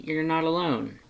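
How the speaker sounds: usual speaking voice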